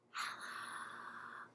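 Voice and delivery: barely audible whispered hello; quiet hello. Shown as a demonstration. Host says hello as quiet as she can, whispered. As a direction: breathe it out as quietly as possible. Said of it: "say hello as quiet as we can".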